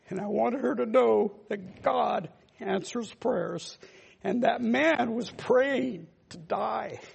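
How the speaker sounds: fighting back tears over a dying mother-in-law; choked up. Real, not acted. Preacher telling his congregation about his dying mother-in-law, choked up. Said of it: "you get a little, little emotional".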